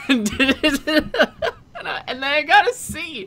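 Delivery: high laughing while telling; giggling through the words. Real, not acted.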